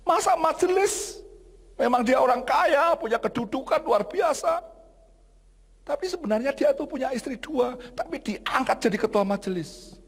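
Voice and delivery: high-pitched, incredulous grievance; indignant complainer. Retold or imitated. Congregant reporting a church elder to the pastor, high indignant complaint.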